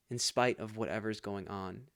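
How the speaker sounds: ordinary delivery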